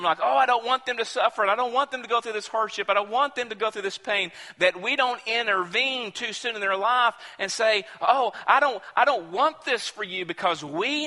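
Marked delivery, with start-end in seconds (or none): 0.2-4.3 quick, fretful pleading as an overprotective loved one. Retold or imitated. Preacher voicing an overprotective loved one, quick and fretful. 8.0-10.3 high, anxious plea to spare someone pain; fretful loved one. Retold or imitated. Preacher voicing a worried friend to a sufferer, anxious.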